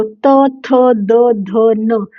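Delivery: त थ द ध न said in quick pairs; dental row again. Shown as a demonstration. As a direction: run through the dental row. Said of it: "जब आप ये पूरी लाइन बोलते हो". (त वर्ग दोबारा)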